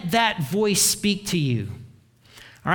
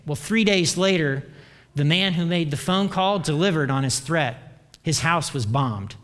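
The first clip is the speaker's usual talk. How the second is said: normal talking voice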